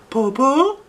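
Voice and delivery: very high, loud questioning call. Acted. Shang calls to the fallen wolf, high and loud. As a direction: call down to check if he's alive. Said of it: "Dao shouted".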